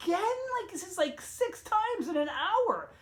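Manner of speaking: high, slow, exasperated whine; incredulous exasperation; exasperated complaint. Retold or imitated. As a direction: drag it out, fed up. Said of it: "I'm like".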